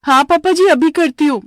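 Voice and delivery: bright eager daughter-in-law; eager reply. Acted. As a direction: chirp it out like a dutiful bride. Bride answers father-in-law eagerly.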